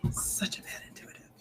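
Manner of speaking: breathy self-scolding whisper under her breath; whispered aside. Real, not acted. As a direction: mutter it to yourself, barely audible.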